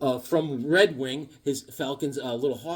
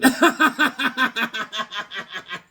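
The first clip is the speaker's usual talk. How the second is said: higher, louder laughter; laugh at own joke; 8 semitones higher, 5 dB louder than usual. Real, not acted. Joke teller laughing at his cowboy pun. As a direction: crack up at the punchline.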